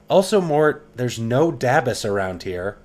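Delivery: loud high laughing reply; excited retort. Real, not acted. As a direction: shout back at the skull. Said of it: "laughing retort to Mort".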